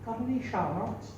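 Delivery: lightly voiced leprechaun. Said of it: "she's playing the part of a leprechaun".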